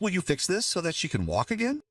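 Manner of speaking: put-on high little girl voice; child voice. A narrator's reading. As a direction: voice a young girl asking. Child voice in story.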